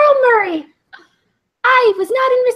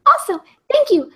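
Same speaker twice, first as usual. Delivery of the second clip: ordinary delivery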